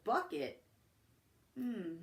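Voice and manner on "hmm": ordinary delivery, nothing marked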